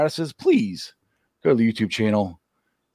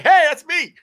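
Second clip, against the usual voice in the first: high-pitched, delighted reenactment; re-enacted burst of thrilled recognition; excited self-quote; 14 semitones higher, 4 dB louder than usual. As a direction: exclaim it like hearing your own name on air. Acts out hearing own name read.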